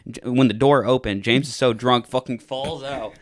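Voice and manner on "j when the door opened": ordinary talk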